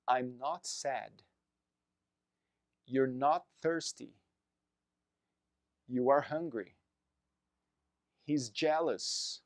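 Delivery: ordinary talk, nothing marked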